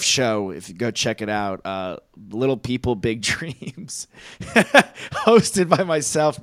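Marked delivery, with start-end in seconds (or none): 4.4-6.4 laughing through the words. Real, not acted. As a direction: crack up while saying it.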